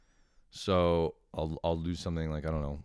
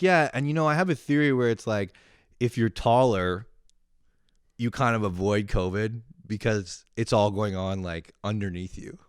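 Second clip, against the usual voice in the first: imitating mom; 4 semitones higher, 6 dB louder than usual. Voicing mom's tall theory.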